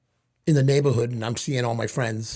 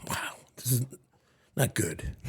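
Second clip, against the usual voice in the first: hushed, breathy dismay under your breath; dismayed whisper; 7 dB quieter than usual. Retold or imitated. Teenage son realizing he has nothing, whispering in dismay. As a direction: whisper it to yourself, crestfallen. Teen dismayed, broke at sixteen.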